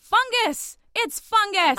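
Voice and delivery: high, loud frustrated answer; exasperated blurt. Acted. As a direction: blurt it out at last.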